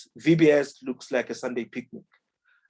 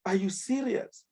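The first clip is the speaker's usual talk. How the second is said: much higher, half-laughing disbelief; incredulous; 10 semitones higher than usual. Retold or imitated. Reacting to the CEO's excuse, high incredulous disbelief. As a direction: pitch shooting up in mock outrage. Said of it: "I'm like".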